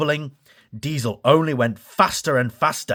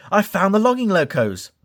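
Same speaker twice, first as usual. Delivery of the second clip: excited; 7 semitones higher than usual. Acted. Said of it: "Thomas exclaimed".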